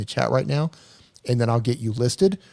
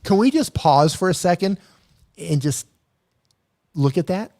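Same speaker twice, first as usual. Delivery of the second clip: excited; 6 semitones higher, 4 dB louder than usual. Real, not acted. Marvelling at the donation total.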